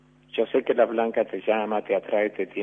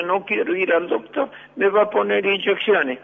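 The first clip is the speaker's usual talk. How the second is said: put-on higher, childish protest against the doctor; whiny child refusing; 10 semitones higher, 4 dB louder than usual. Child tells mom he won't go, higher whiny refusal. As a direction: sulk like a kid who fears shots.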